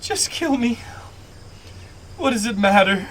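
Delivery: high, pleading despair; soft despairing voice. Acted. Sobbing woman in a bush to her armed pursuer, despairing. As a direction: pitch it up like someone distraught. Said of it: "you hear a soft voice go".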